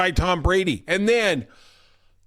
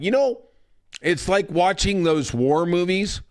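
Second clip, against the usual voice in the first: ordinary delivery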